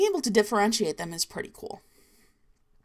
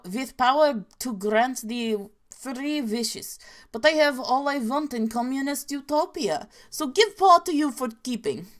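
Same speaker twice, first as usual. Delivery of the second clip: higher-pitched, hammed-up Russian accent storytelling; cartoonish Russian accent; 4 semitones higher than usual. Telling Yevdokia's monkey paw tale, cartoonish Russian accent. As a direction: tell it in thick cartoon Slavic English. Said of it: "cartoonishly Slavic".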